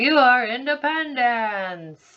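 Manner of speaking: high, slow, loud declaring voice; high drawn-out alien decree; grand alien proclamation. Retold or imitated. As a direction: announce it like a proud little alien. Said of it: "he's like".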